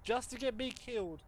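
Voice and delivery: exasperated complaint. Real, not acted. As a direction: pitch jumps up in annoyance. Annoyed warning to game.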